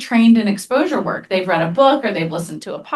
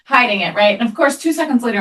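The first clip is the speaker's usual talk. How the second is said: normal talking voice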